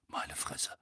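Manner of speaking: low, whispered, feeble; faint. A narrator's reading. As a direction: breathe it out, drained with shock. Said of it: "sagte Mandangus matt".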